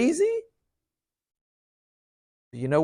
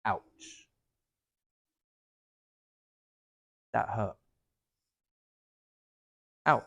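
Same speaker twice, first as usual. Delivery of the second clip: much softer, lower, slowly saying ouch; quiet hurt ouch; 9 semitones lower, 10 dB quieter, slower than usual. Shown as a demonstration. Telling the one who hurt you, softly, that it hurts. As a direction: calmly let them know it hurts. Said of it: "You just say".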